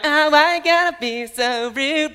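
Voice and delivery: high, slow, put-on mocking girl. Mocking the girls in the car, a high put-on voice. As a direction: mimic the girls teasingly.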